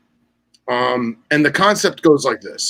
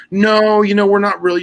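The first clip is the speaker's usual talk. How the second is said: higher, louder dismissive no; curt official rejection; 8 semitones higher, 5 dB louder than usual. Retold or imitated. Agency turning down the fishermen applicants, firm refusal. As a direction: brush the applicant off firmly. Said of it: "Fish and Wildlife says".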